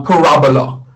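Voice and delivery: paRAbola, stress on the RA; stress on second vowel. Shown as a demonstration. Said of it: "the emphasis on the second vowel".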